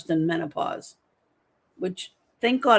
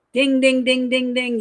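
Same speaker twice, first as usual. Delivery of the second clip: higher and louder bell-like dings; game-show ding; 5 semitones higher, 5 dB louder than usual. Acted. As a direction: ring it out like a quiz-show bell. Marking a right answer.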